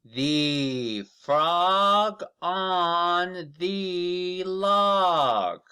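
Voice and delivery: very slow, high and loud, each word stretched; slow model reading. Shown as a demonstration. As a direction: read it out slowly for children to copy. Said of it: "Now let's read it together".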